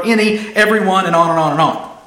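ordinary delivery

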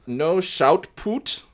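high, slow mock Russian accent; attempted Russian accent. Russian girl angry about no shot put, hammed-up Russian accent. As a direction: read it phonetically as a Russian girl. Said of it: "trying to read this phonetically to get the Russian accent".

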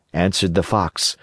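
ordinary delivery

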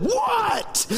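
high-pitched exasperated mom's yell; mock shriek of exasperation; violent snap. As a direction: snap back like a pestered mother. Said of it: "It sounded violent through my door".

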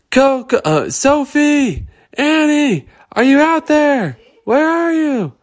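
high, loud calling. Acted. Girls call high and loud for missing friends. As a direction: shout into the dark for them.

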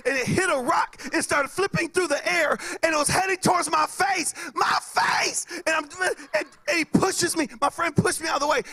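high-pitched frantic retelling; mock panic. Comic retelling a firework heading for his face, acted panic. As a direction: relive it in squealing alarm.

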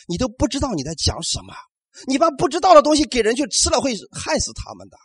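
ordinary delivery

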